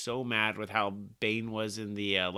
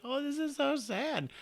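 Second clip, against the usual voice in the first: much higher, slow, mock-tearful coo; mock pity; 3 dB quieter than usual. Comic reader reacting to baby Bane in prison, high mock-sad coo. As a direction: gush over it like it's a sad puppy.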